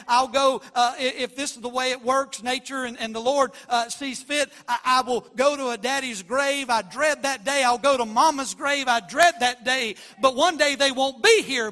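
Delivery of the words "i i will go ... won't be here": louder, rising preacher's cry; a pastor crying out over his parents' graves; preaching at full force. Acted. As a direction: preach it out, building toward the shout.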